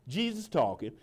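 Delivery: usual speaking voice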